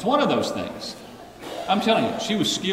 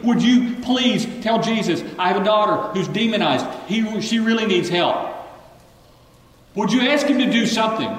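raised, urgent pleading plea; pleading mother; 6 semitones higher, 4 dB louder than usual. Retold or imitated. Mother begging disciples for help, raised pleading. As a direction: beg the disciples for your daughter. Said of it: "she's pleading".

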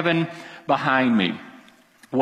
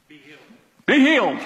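authoritative command; 3 dB louder, faster than usual. Retold or imitated. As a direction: pray it as a short, firm order. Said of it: "He prayed with authority".

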